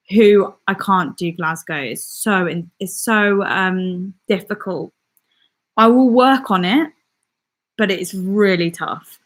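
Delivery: ordinary talk, nothing marked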